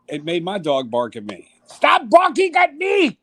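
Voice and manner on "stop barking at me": high barking shout; dictator shout. Shown as a demonstration. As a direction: yell the order like a tyrant. Repeats dictator yell at dog.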